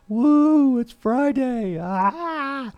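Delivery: high, loud Friday whoop; excited whoop. Pretend. Host whooping to listeners that it's Friday, high and loud. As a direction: let it rip like you want to jump up and down. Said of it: "jump up and down and scream and shout and holler".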